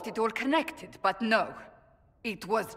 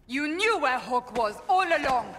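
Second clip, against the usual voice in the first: yelled accusation, higher and louder; furious shout; 6 semitones higher, 5 dB louder than usual. Acted. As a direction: hurl it at him like a betrayal. Cassandra confronts Varric, betrayed.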